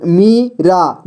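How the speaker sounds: Meera slowly as मी, pause, रा; syllable by syllable. Shown as a demonstration. Teacher showing beginners how to read Meera, syllable by syllable. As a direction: break Meera into two long syllables. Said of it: "जोड़ जोड़ करके ही पढ़ना होगा".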